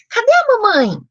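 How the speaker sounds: put-on high, loud question to a baby; pergunta para bebê. Shown as a demonstration. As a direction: ask a baby in a high sing-song. Models question asked to baby. (pergunta aguda, como para bebê)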